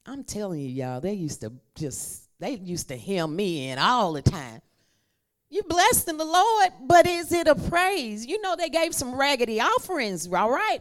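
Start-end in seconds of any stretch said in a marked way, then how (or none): 5.5-10.8 high, loud preaching; preaching at full force. Acted. Preacher rebuking raggedy offerings, loud and high. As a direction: lift it up and drive it home.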